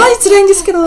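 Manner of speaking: high, loud bubbly gyaru complaint; Heisei gyaru voice. Shown as a demonstration. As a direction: whine it like a Heisei gal. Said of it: "平成のギャル風に言うと".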